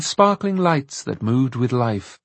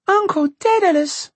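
put-on very high, excited little girl; joyful cry; 3 dB louder than usual. A narrator's reading. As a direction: cry out in high-pitched delight. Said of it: "she cried out".

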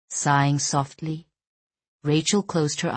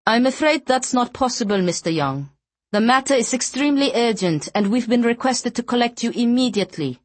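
put-on Norwegian accent; 7 semitones higher than usual. Blonde escort insists on urgency.

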